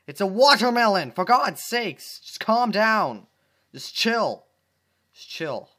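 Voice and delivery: loud, high exasperated yelling; frantic yell. Acted. Dash scolds herself over the watermelon, loud and frantic. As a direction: yell at yourself to calm down.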